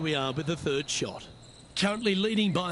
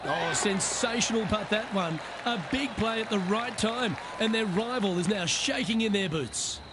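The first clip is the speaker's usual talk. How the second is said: golf commentator; 4 semitones higher than usual. Acted. Big putt drops late on.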